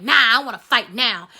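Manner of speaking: loud, blunt refusal; imitating a girl itching to fight; eager to fight. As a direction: shut her down and demand the fight. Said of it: "She was like".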